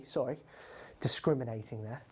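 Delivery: whispered, under the breath; a sheepish whisper to himself; whispered aside. Real, not acted. Quiz host mocking his own pronoun slip, whispered.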